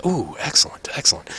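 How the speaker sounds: softly gloating gambler, dropping to a whisper; hushed, savoring delight; scheming gambler. Acted. Gambler delighted with his pairing.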